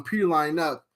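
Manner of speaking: ordinary talk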